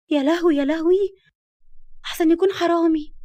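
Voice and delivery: high, loud panicky wail; alarmed. Acted. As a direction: panic at the thought of a burglar. Alone at night, fears a burglar.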